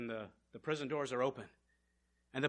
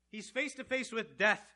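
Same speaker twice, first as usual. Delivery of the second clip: ordinary delivery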